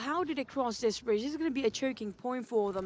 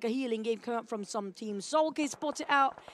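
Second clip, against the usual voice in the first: usual speaking voice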